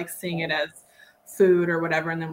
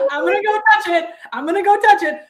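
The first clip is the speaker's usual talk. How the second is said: put-on high, loud, giddy child's boast; loud mock-daring kid voice; imitating excited kid; 10 semitones higher, 8 dB louder, faster than usual. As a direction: mimic a thrilled little rebel, pitched way up. Imitating excited young son daring himself.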